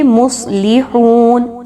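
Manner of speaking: مصلحون very slow with a thick, heavy ص; مصلحون recited slowly, ص thick; heavy saad. Shown as a demonstration. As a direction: drag out مصلحون, the ص full and heavy. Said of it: "صاد کو خوب موٹا کر کے پڑھیں گے".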